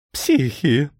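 high, tearful whine; crying. A narrator's reading. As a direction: squeal it out in tears. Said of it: "плакал волшебник". (плаксиво, высоким голосом)

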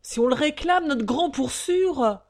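high, loud eager outburst; emphatic cry, stretched at the end; jumping in. A narrator's reading. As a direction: leap in to claim your son. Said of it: "Et Chopin de ressauter".

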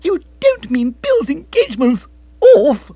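very high, loud, excited squeal; excited squeak. A narrator's reading. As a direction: squeak out the shocked question. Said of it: "Monica squeaked excitedly".

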